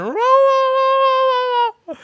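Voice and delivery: high, squeaky imitation of a small dog going raw raw; little dog yaps. Imitating the shop dog yapping back at the repair price. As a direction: yip like a tiny dog protesting. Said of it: "And then Mishu went".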